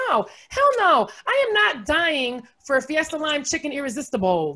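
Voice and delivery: high, loud comic outrage; ranting in mock outrage; indignant refusal. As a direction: refuse it point-blank, voice jumping up. Comic rant refusing to die.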